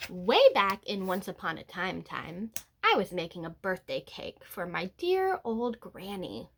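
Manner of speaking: usual speaking voice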